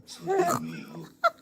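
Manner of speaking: a real burst of high breathless laughter; high breathy laugh. Real, not acted. Laughing at her own story.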